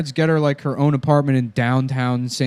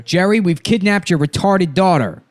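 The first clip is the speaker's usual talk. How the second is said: higher-pitched agitated kidnapper; Buscemi; 6 semitones higher, 3 dB louder than usual. Acted. Kidnapper breaking news to Jerry, Buscemi impression. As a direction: announce it as an excitable movie crook. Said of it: "Why is he so fucking hard to do?".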